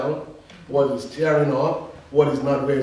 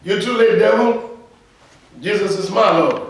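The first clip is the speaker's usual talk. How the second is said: raised, high declaration; declaring to the devil; 6 semitones higher, 6 dB louder than usual. Shown as a demonstration. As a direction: shout it down at the devil. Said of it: "Say with me".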